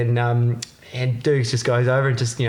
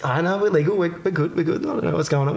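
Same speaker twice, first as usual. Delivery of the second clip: nervous thought; 5 semitones higher, faster than usual. Retold or imitated. Nervous inner thought at cop.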